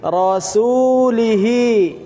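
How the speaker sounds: rasulihi, slow, the final hi drawn out; long hi. Shown as a demonstration. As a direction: stretch the hi in rasulihi. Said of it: "hi-nya panjang".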